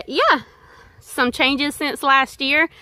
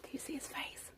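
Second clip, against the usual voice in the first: whispering; 25 dB quieter than usual. Real, not acted. Whispering near a wild animal.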